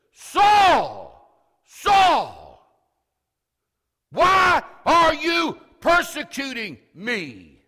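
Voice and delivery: loud, slow shout with authority; booming, drawn-out commanding holler; emphatic call. Shown as a demonstration. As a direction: call out each phrase at full force. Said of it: "he says with an emphatic call".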